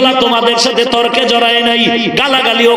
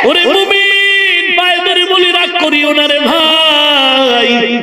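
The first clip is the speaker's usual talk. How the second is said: pleading call; 5 semitones higher, slower than usual. Real, not acted. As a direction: beg the believers, drawn out.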